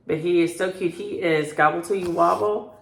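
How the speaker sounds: ordinary delivery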